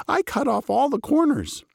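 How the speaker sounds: high, simple-minded baker voice; Muddle voice. A narrator's reading. Baker to his boss, high and proud of himself.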